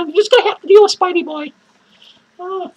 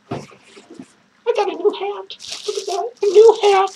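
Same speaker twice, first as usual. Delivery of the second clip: usual speaking voice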